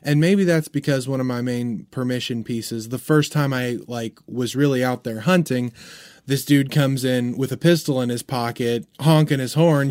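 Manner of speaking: ordinary talk, nothing marked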